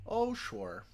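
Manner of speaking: a hint of a Minnesota "oh, sure"; lightly voiced Upper Midwest drawl on "Oh, sure"; mild Minnesota twang; Minnesota accent. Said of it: "it's okay. It's not my best".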